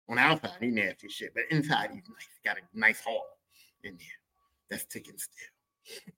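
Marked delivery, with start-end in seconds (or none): 3.8-6.1 breathy whisper, slow; whispered aside. Real, not acted. Comic whispering to the crowd about his dad's heart, whispered. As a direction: drop it to a secret.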